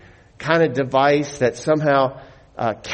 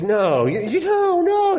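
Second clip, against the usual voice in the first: put-on high, pleading little boy; crying child; 14 semitones higher than usual. Son begging dad not to hurt the bat, crying. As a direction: plead in a much higher, tearful child's voice. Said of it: "And he's crying".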